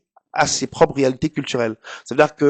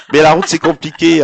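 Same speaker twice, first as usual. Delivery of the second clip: laughing; 5 semitones higher, 8 dB louder than usual. Real, not acted. Laughs at the road joke.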